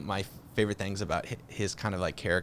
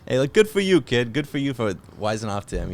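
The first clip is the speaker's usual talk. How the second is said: De Niro approving; 3 semitones higher, 6 dB louder than usual. Retold or imitated. De Niro praising the kid's nerve, mock hearty. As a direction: egg the kid on. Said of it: "De Niro is just like".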